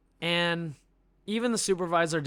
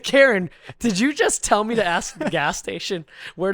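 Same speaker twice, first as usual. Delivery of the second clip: louder, higher disbelieving question; incredulous outburst; 3 semitones higher, 8 dB louder than usual. Retold or imitated. Caller retelling his shock at Karen, loud and incredulous. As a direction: demand it in disbelief.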